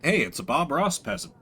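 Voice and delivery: ordinary talk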